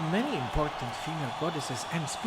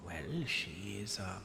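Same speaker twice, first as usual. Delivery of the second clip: ordinary delivery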